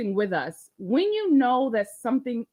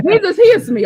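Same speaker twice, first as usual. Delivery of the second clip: excited; 7 semitones higher, 12 dB louder than usual. Pretend. Celebrating the fix.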